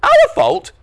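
very high, loud squeak; indignant disbelief. A narrator's reading. Brian blamed by Norm, indignant. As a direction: squeal it like an accused kid.

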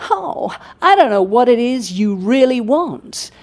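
a hint of a glossy, entitled young man; entitled male student. Male student sneering at Germaine Greer, lightly mocked. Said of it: "frightfully glossy and frightfully entitled".

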